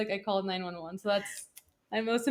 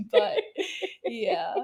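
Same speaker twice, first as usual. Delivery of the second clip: nervous laugh; 7 semitones higher, 4 dB louder, slower than usual. Real, not acted. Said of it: "this is a nervous laugh".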